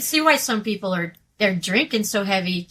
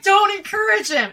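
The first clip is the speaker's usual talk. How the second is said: a high, loud shout at his friend; retold shout; 9 semitones higher, 3 dB louder than usual. Al telling her not to egg him on, shouting. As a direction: bark it out, half-joking. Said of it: "He's like".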